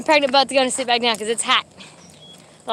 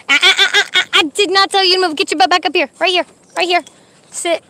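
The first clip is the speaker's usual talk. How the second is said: loud, raised, rapid-fire reprimand; scolding the dog; 5 semitones higher, 6 dB louder than usual. Real, not acted. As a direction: correct a disobedient dog firmly.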